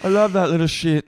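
high, mock-fond gush; lightly voiced fond boss. Boss on waitress's dog, mock-fond gushing. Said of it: "And Lisa goes".

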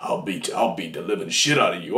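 very low, hammed-up possessed voice; creepy voice. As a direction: menace in a deep, put-on creepy voice. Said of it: "let me try my creepy voice".

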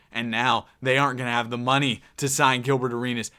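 ordinary delivery

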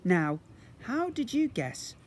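slow, teasing, smiling; cheeky boy. Acted. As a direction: say it with a cheeky grin. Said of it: "with a cheeky smile".

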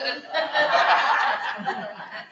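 high airy laughter at the punchline; real laugh. Real, not acted. Preacher laughing along with the congregation at the joke.

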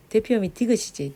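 usual speaking voice

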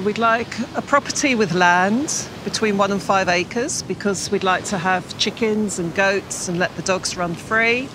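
normal talking voice

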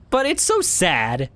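ordinary talk

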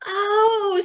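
high, loud little bug voice; a surprised tiny ladybug. Acted. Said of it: "said the ladybug".

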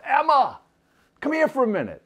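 high, loud call down the hall. Acted. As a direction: yell her name sweetly from the room. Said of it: "he yells out".

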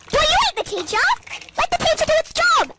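indignant high rebuke; mock scolding. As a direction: snap at the teacher's pet. Scolding the teacher's pet.

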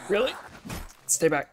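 shouted stay back; loud warning. Real, not acted. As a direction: bark it at the zombie. Warning a zombie.

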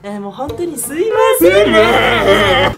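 loud, slow, cracking laugh-cry voice; mother's voice distorting into horror; voice breaking. Retold or imitated. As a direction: let the voice fall apart. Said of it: "お母さんのこの声が壊れていく".

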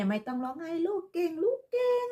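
put-on high, sing-song cooing, as if to a crying child; mock motherly soothing. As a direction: coo it in a high pretend-mummy voice. Mock-soothing someone addressed as her child.

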